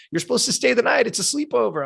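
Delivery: high-pitched imitation of a mother objecting; protesting mom. Mom objecting to her kid leaving a sleepover, protesting. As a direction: voice mom's disbelief well above your own pitch.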